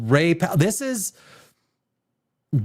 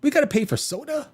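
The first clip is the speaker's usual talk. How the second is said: higher, incredulous question; mock indignation; 7 semitones higher, faster than usual. Jonah Hill's character balking at paying for soda, incredulous. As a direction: ask it as if outraged at the price. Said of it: "And he goes".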